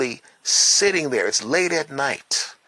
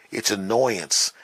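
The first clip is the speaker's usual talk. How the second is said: ordinary talk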